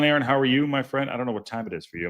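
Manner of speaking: usual speaking voice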